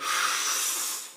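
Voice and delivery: forceful noisy breath; long rushing breath demo; huge deep breath. Shown as a demonstration. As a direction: breathe like mad, all air and no voice. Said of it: "take a breath like mad".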